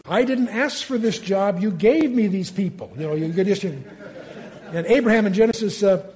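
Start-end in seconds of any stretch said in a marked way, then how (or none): 0.1-2.9 complaining Moses. Retold or imitated. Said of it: "How often the Moses say".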